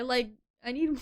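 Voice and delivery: ordinary delivery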